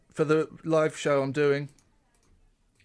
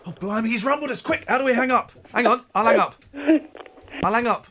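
flustered panic; 5 semitones higher, faster than usual. Real, not acted. Rumbled during prank call.

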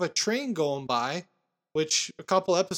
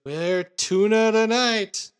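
Harry's line; 5 semitones higher, 7 dB louder, slower than usual. Retold or imitated. Harry voiced in retold scene.

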